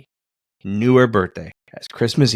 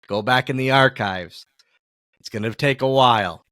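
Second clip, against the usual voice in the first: ordinary talk, nothing marked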